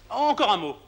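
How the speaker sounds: calling her back loudly; urgent call. Acted. Calling after leaving countess.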